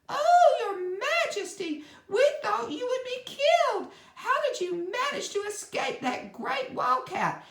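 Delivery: frightened subjects crying out to their queen; exclaiming mice. A narrator's reading. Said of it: "they exclaimed".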